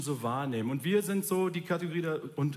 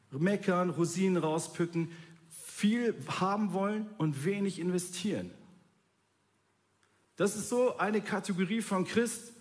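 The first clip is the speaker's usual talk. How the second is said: ordinary talk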